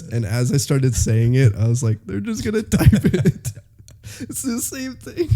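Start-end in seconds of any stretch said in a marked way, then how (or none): 2.0-5.3 high giggly words; laughing. Retold or imitated. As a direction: crack up while saying it. Laughing at own joke.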